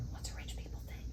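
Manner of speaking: breathy aside under the breath; whisper. Real, not acted. Whispering to the co-host mid-bit. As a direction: whisper it like a secret question.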